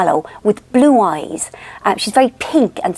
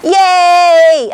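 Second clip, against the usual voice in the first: loud, high, drawn-out yay; answering happy squeal; 8 semitones higher, 6 dB louder than usual. Retold or imitated. Aunt answering her baby niece, loud high held squeal. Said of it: "And then I go".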